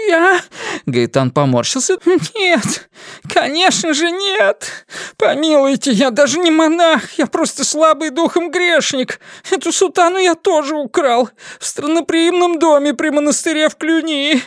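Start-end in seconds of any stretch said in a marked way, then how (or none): 0.1-0.4 startled squeak. Acted. Prisoner startled. 2.3-14.4 high, anxious whining plea; frightened pleading. Acted. Prisoner pleading with inquisitors.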